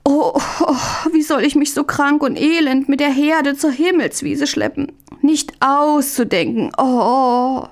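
high, tearful groaning of fake misery; feigned whimpering. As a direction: whimper and sob, overplaying the illness. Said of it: "Möndlein ächzte noch stärker". (hohes, weinerliches Ächzen)

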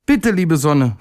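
high, urgent plea; pleading call. A narrator's reading. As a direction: beg the sun in a little character's voice. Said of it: "rief er".

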